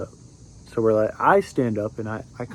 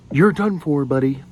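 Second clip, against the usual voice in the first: raised, punchy mock shout; retold yell; 5 dB louder than usual. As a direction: imitate them yelling, louder. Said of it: "they are screaming".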